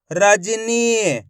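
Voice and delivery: "रजनी" very slowly as one word; slow teaching read of "रजनी"; blended, drawn-out word. Shown as a demonstration. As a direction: hold the final ee of "रजनी". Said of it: "आपस में मिला के पढ़ेंगे".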